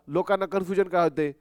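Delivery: ordinary delivery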